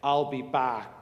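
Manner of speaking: a light Austrian accent imitating Schwarzenegger. Preacher quoting the movie line in a mock Austrian accent. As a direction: put a little Austrian accent on it. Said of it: "in that rich Austrian accent".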